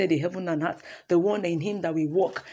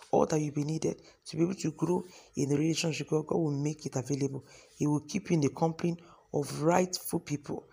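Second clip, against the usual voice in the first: soft, even prayer voice; quiet blessing prayer; 2 semitones lower, 4 dB quieter than usual. Acted. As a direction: pray over the listeners gently.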